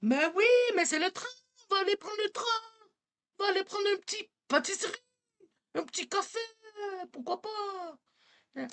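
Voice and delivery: high, fast Quebec French accent; hammed-up Quebec French accent, laughing; accent québécois exagéré. Shown as a demonstration. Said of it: "That's Quebec".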